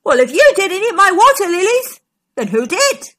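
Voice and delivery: high and loud storybook exclamation; exclaimed cry. A narrator's reading. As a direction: cry out the challenge, indignant. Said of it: "Stegosaurus cried".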